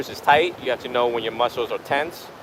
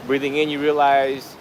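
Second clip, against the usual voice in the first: guided meditation; 6 dB quieter than usual. Real, not acted. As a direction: guide the breath unhurriedly, phrase by phrase. Leads a breathing meditation.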